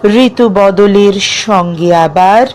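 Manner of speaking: measured verse reading with long pauses between lines; poem recitation. Acted. Reciting a self-written poem about dreams to listeners, measured poetic delivery. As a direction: recite it phrase by phrase, lifting some line endings, letting others fall.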